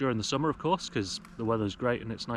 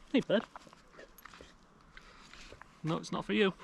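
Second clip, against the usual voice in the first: high-pitched dog-directed voice; talking to dog; 8 semitones higher, 4 dB quieter than usual. Real, not acted. Owner tells his dog the food isn't his, high pet-talk voice.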